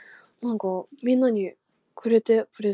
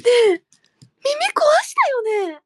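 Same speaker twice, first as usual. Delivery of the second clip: higher and louder, startled; alarmed outburst; 12 semitones higher, 7 dB louder, faster than usual. Real, not acted. After a loud noise, startled shout at the listener. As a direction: blurt it out in shock.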